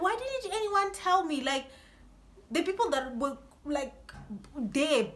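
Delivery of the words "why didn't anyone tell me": mock outrage. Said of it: "I was like".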